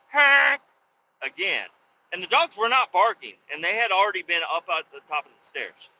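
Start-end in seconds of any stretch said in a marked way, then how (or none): 0.1-0.6 short, high, loud horn honk; quick blast of an air horn; imitated air horn. Neighbor peeking round the corner at the dogs, blaring honk. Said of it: "He goes".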